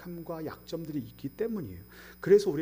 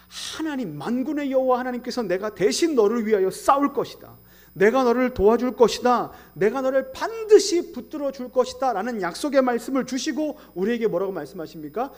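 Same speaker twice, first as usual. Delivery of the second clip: loud, higher, ringing proclamation; forceful preaching; 8 semitones higher, 9 dB louder than usual. Acted. Pastor proclaiming God will fight for them, loud. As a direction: proclaim God's promise with full force.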